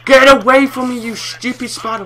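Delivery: loud, high yell at an attacker; shouting in panic. Real, not acted. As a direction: shout to drive it off. Spider attacking him.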